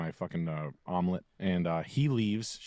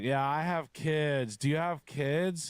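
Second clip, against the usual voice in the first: put-on slow, higher drunk chattiness; drunk woman; 5 semitones higher, slower than usual. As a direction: stretch it out like a tipsy bar patron. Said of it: "She was hammered".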